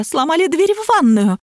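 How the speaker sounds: high, plaintive mock wail; tearful outcry. A narrator's reading. Lapulya reporting the broken bathroom door, tearful high cry. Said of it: "всхлипнула Лапуля".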